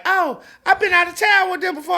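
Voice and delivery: high-pitched mock wife; wife's voice. Man retelling what his wife told him, high mock wife. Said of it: "she was like".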